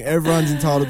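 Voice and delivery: usual speaking voice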